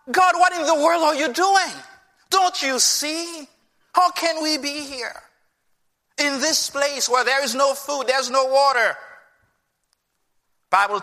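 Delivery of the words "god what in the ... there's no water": higher-pitched accusing complaint hurled at God; protesting heavenward; indignant complaint. Acted. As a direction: demand answers from God, aggrieved. Acting Israelites complaining to God.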